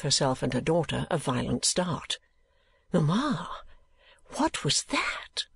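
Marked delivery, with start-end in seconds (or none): 2.9-5.5 hushed, half-whispered question; low tone. A narrator's reading. Daughter startled by a noise, asking in a low whisper. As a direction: ask slowly under your breath. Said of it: "in a low tone of voice".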